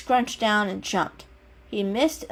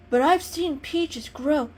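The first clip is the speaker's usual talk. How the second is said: lightly raised, earnest little kitten; a small cat explaining himself sincerely; 7 semitones higher than usual. Acted. Kitten voices his wish.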